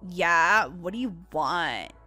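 very low, bored reply; surly employee. Acted. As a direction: answer as if the customer is a bother. Unwilling worker answers customer.

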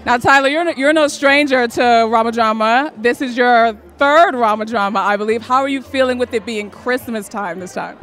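ordinary talk

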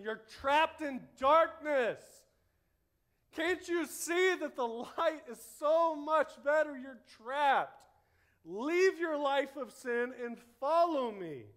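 high, impassioned shout with drawn-out key words; urgent plea. Acted. Preacher as Jesus pleading with the trapped, urgent shout. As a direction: cry out to save them, loud and high. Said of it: "There's a sense of urgency here".

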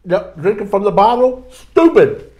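self-mocking. Real, not acted. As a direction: jeer at yourself for a dumb move. Mocking his own dumb move.

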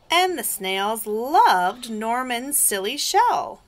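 expressive storytime voice; picture-book narration. A narrator's reading. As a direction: lean on key words, drawing them out. Reading picture book aloud.

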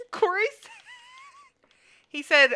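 high, squeaky words through laughter; helpless giggling, pitch shooting up; laughing hard. Real, not acted. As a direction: barely get it out for laughing. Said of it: "I'm laughing so hard right now".

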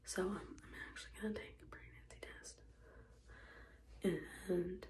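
soft early-morning whisper. Real, not acted. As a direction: whisper so nobody wakes up. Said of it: "so I'm trying to be quiet".

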